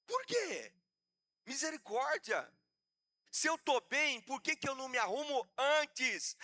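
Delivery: high, impassioned rhetorical questions; fiery preaching. Real, not acted. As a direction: drive each question at the congregation. Preaching at full force.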